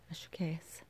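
whispered aside to herself; softly unvoiced, just for herself. Real, not acted. Unsure aside about plant name.

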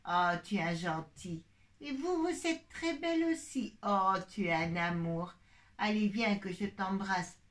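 voix de maman posée, bien plus grave. Acted. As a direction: une maman douce qui parle à sa fille. (la maman demande à Camille comment elle va, voix grave)

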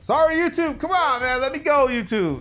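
high, loud playful begging; loud high-pitched hammed-up plea; mock pleading to YouTube. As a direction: beg YouTube like a whiny kid.

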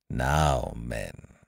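low, quiet, stern address; deep, hushed sternness. Acted. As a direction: command attention without raising the voice. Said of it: "said Lowe sternly".

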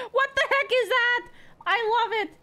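high-pitched, excited squeal; delighted amazement. Real, not acted. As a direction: burst out of laughter, high and delighted. Delighted laughing reaction on stream.

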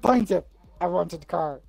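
ordinary talk, nothing marked

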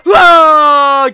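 loud drawn-out shout; startled shout. Real, not acted. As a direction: yell in surprise. Doll falls.

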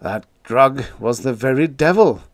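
ordinary delivery

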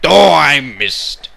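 slow, loud drawn-out shout; loud exclamation. Real, not acted. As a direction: shout it out slowly. Shouted slowly.

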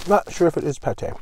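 speaking through a bite; chewing while talking. Real, not acted. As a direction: mouth full of sandwich. Seen 1: Bites at -2.0, jaw and cheek working early; then face hidden.

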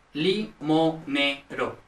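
limonero very slowly, broken into li, mo, ne, ro; syllable by syllable. Shown as a demonstration. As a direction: spell out limonero in separate syllables. Mnemonic split into syllables. (limonero, sílaba por sílaba, muy despacio)

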